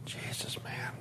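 breathless whisper to yourself; whispered thought. Retold or imitated. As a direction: whisper it, stunned. Thought to himself driving home.

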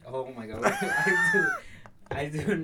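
shocked high-pitched laugh. Real, not acted. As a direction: squeal out an oh my God. Reacts to awkward question.

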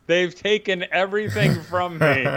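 high-pitched mock lament; retold tearful cry. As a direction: wail it lightly, quoting an upset friend. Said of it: "sat down and cried and said".

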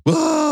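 high mock shriek of hyper kids; kids' shriek. Dad mimicking kids high on cake at a party, a high mock shriek. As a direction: let out a wild party-kid squeal.

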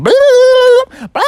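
loud, high silly tongue noise; tongue-out blah. Acted. Froggy sticking out his long tongue, loud silly blah. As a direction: blurt a drawn-out silly blah.

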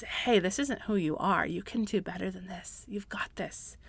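Holy Spirit's voice. Retold or imitated. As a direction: gently lift someone up, very soft. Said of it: "It says".

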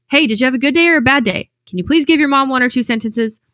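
insist, hey, give your mom a sentence; persistent asking. Retold or imitated. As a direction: mom pressing her son for an answer, loud. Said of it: "I persisted".

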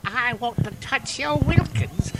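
put-on old lady. Comic mocking British old ladies, lightly voiced old lady. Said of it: "These old ladies are like".

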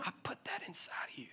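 soft, unvoiced whisper; God whispering to him. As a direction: breathe it like a secret. God speaks intimately.

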